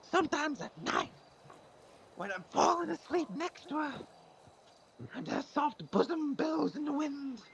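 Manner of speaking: very high, slow, mournful moth; sad moth voice. Acted. Moth complaining to the foot doctor, very high and mournful. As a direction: wail like a heartbroken moth. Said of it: "What is that voice?".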